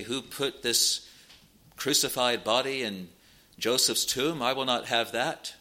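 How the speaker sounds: usual speaking voice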